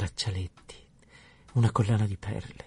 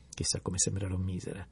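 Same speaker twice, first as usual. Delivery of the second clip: whispered lament; 3 semitones lower, 8 dB quieter than usual. A narrator's reading.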